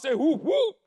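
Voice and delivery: loud, rising crowd call; hype-up shout. Acted. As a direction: pump the room up with a big shout.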